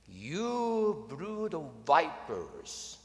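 slow, drawn-out prophet's condemnation; John the Baptist's rebuke. Retold or imitated. John the Baptist rebuking the crowds, slow and stern. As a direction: thunder it slowly at the crowd. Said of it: "This is the way he spoke".

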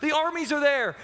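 high-pitched, hammed-up panic; mock freak-out. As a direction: act out an apprentice panicking at an approaching army. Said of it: "the apprentice is freaking out".

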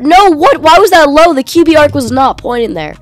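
loud, high outburst of disbelief; exasperated protest. Real, not acted. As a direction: complain hotly at the game. Protesting a bad throw.

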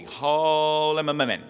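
"Ha le ma ma men" slow and drawn out, words run together; slurred drawn-out prayer. Retold or imitated. Aunt leading the rosary, slow blurred chant. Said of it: "she always held the holy".

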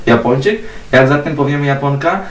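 normal talking voice

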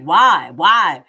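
loud, exasperated why. Real, not acted. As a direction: throw up your hands and demand why. Host exasperated by the tactic.

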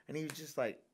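usual speaking voice

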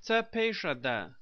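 Tepejada with a sh sound for the j; alternative pronunciation. Shown as a demonstration. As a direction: say Tepejada as ta-pay-sha-da. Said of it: "also pronounced".

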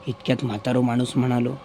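plain reading voice

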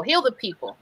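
normal talking voice

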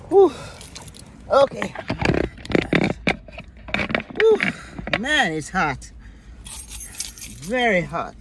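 puffing, short of air in the heat; out of breath. Real, not acted. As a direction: gasp for air between words. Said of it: "so hard to breathe in the mask".

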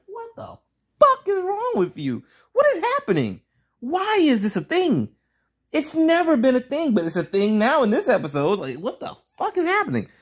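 high-pitched, baffled ranting; exasperated disbelief. Real, not acted. Reviewer baffled by Ivy fawning over Catwoman, exasperated. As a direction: pitch right up, demand an explanation.

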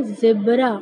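ज़ेब्रा begun with a buzzing z; z sound in ज़ेब्रा. Shown as a demonstration. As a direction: say ज़ेब्रा as "zebraa". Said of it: "अब ज़ेब्रा तो नहीं पढ़ सकते".

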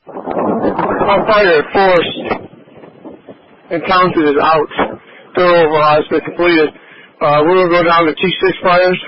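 wind-distorted radio. Real, not acted. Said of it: "winds blowing in your radio".

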